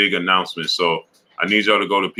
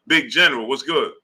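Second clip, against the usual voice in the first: normal talking voice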